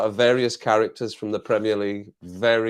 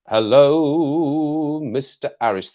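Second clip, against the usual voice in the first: high, loud drawn-out hello; called greeting; 4 semitones higher, 5 dB louder, much slower than usual. Real, not acted. Streamer greets a viewer joining the chat, called greeting. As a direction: hail an arriving friend.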